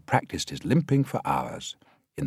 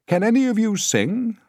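much higher, louder director voice; director's question; 7 dB louder than usual. A narrator's reading. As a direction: call the question out to the crowd. Director asks the crowd.

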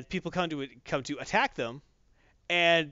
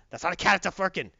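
raccoon voice. Retold or imitated. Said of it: "says the raccoon".

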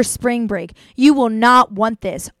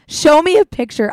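higher and louder, a pushy command; bossy demand; 6 semitones higher, 7 dB louder than usual. Shown as a demonstration. Vetting a cute boy at a party, pushy and loud. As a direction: demand it like an interrogation. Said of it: "that's literally what I do".

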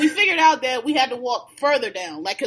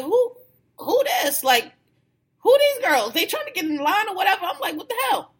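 much higher, puzzled questions; retold confusion; 7 semitones higher than usual. Retelling his confusion when strangers hug him, high and baffled. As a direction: act baffled by these strangers.

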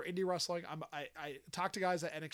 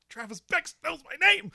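high, loud starstruck outburst; freaking out; 13 semitones higher, 13 dB louder than usual. Retold or imitated. Fan realizes a wrestler knows him, loud high excitement. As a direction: blurt it out, higher and louder, dazzled. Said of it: "freaking out in my head".

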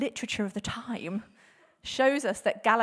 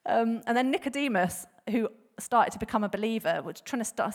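normal talking voice

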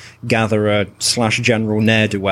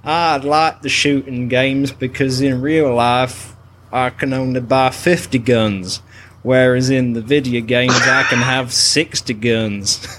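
mock American accent. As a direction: play a gun-loving Southern American. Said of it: "introduce the show in an American accent".

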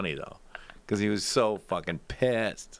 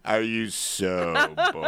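slow teasing question; teasing drawn-out; slower than usual. Retold or imitated. Greeting Matt with a slow drawn-out tease. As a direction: stretch it out to needle him. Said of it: "I go".

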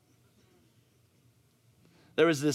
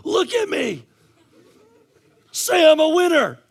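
high, loud coach's shout; rallying shout; 10 dB louder than usual. Retold or imitated. Coach to crying kids in the dugout, rallying shout. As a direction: yell to fire up a losing team.

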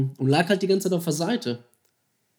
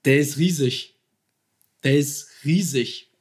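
louder, then slower repeat in awe; emphatic, slowing amazement; awed insistence; 4 dB louder, slower than usual. Retold or imitated. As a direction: press the size home. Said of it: "Ich sag".

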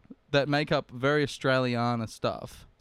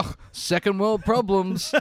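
slow, higher mocking drawl of a local; lightly put-on accent, slow and raised; mock foreign voice; 6 semitones higher, 4 dB louder, slower than usual. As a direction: play up a weary local shrugging it off. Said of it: "and they go".

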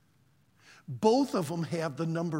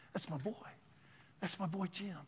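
soft, much quieter than usual talk; quiet fatherly pride; 11 dB quieter than usual. Retold or imitated. God telling of his faithful servant, quiet pride.

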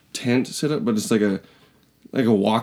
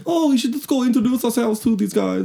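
high, silly mock of their own plan; 12 semitones higher than usual. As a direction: mimic the naive plan in a high singsong. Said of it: "mocking their own plan".

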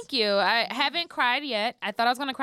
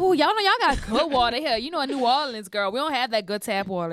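New Orleans auntie voice. Pretend. To friends, mocking how she'll talk at the office, light New Orleans accent. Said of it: "I'm gonna talk like that".

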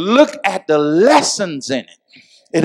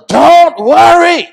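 preacher's command; 11 semitones higher, 7 dB louder, slower than usual. Acted. Climactic command, very high, loud.

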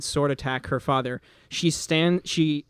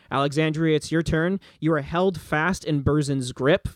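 usual speaking voice

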